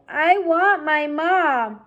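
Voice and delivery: slow, high wail; crying. Acted. Mig crying again, slow tearful wail. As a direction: plead it out tearfully. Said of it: "cried Mig".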